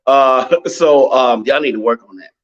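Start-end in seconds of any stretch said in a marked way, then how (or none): none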